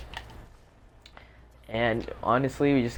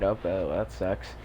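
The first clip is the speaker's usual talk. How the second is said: emotionless shrug; 3 semitones lower, 5 dB quieter than usual. Retold or imitated. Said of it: "I didn't really have much emotion".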